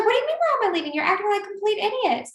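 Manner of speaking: high, sharp indignant retort. Retold or imitated. Speaker scolding the frat guys, high indignant retort. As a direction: fire it back at them, indignant. Retelling her angry retort.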